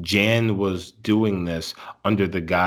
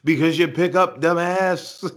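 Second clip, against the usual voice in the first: high, laughing taunt; mock smug Bob; 8 semitones higher than usual. Bob taunting the mom for picking up, laughing mock sneer. Joining the acted Bob reply.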